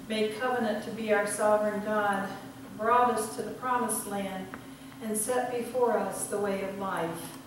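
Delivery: solemn, measured liturgy voice; liturgical reading. Acted. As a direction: intone the liturgy to the congregation. Leads communion liturgy.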